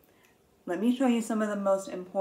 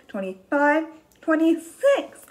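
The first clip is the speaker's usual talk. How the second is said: excited; 7 semitones higher, 6 dB louder than usual. Shown as a demonstration. Counting climax.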